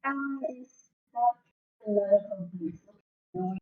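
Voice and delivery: choppy cutting-out audio; bad connection, words dropping; buffering stutter. Real, not acted. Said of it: "you cut out for the first part".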